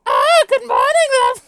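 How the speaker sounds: very loud, high, hammed-up British greeting; joke British accent. Reader greets the group as a joke, shrieky mock British accent. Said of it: "give her a little British accent".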